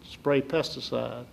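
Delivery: ordinary talk, nothing marked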